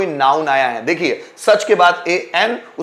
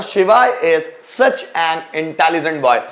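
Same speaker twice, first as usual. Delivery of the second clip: slow dictation; much slower than usual. Shown as a demonstration. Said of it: "teacher dictates example sentence".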